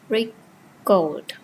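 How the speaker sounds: "record" split up, re... CORD; second-syllable stress. Shown as a demonstration. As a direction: pause inside "record" before a full CORD. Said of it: "stress falls on the second syllable".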